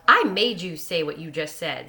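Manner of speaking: gleeful, loud boast; joyful. A narrator's reading. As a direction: crow it with delight. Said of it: "Sko replied joyfully".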